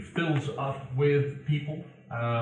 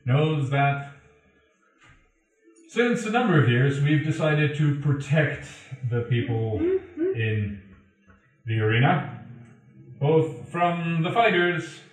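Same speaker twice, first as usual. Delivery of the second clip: arena announcer; 2 semitones higher, 5 dB louder than usual. Acted. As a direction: address the crowd as the arena herald. Game master voices an arena announcement.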